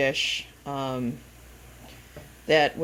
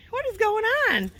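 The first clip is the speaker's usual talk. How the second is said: much higher, astonished question; retold disbelief. To the viewer about the ducks, high astonished. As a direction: ask it amazed. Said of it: "So we were like".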